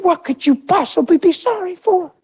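high-pitched retold tearful question; crying. Crying husband questioning his sorry wife, high retold pleading. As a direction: plead in a high, mock-weepy voice. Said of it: "And I was crying".

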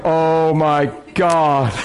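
very slow, drawn-out dismay; stunned alarm. Retold or imitated. Lady watches a big man running at her, stunned alarm. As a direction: stretch each word out in mock dread. Acting the woman watching him charge over.

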